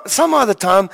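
ordinary delivery

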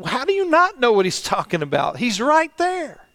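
baffled, rising high at the end; disbelieving outburst, slowing on the last words; incredulous younger self. Retold or imitated. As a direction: scoff at how blind they seem. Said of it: "I would look at the, this and say".

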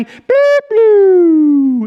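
high beep, then long loud falling whoop; imitated meter noise. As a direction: mimic a gadget beeping and powering down. Imitates sin meter resetting.

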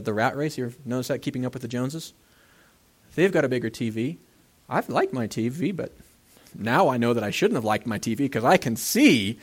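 normal talking voice

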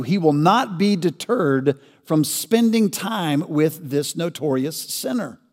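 ordinary talk

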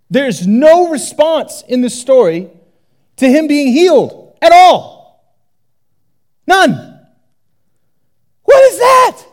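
preacher's growing outrage; indignant build. Real, not acted. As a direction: rise to an outraged cry.

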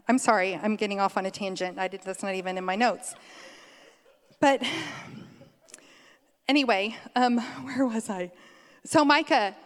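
ordinary talk

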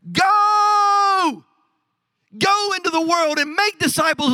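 loud, high ringing command to go; Jesus's great commission. Acted. Preacher booming Jesus's command to his disciples, loud and high. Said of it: "Here's how it went".

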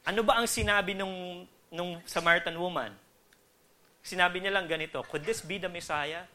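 normal talking voice

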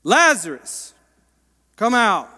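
loud, ringing call to the dead man; shouting command. Acted. Jesus calling Lazarus out of the tomb, a loud commanding shout. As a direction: bellow it into the tomb. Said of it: "and he shouted".

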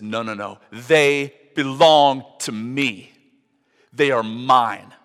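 Jesus loudly refusing Satan, slow and firm; firm refusal. Acted. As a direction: plant your feet and claim them.

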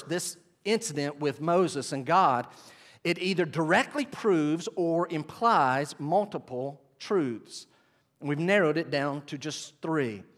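ordinary delivery

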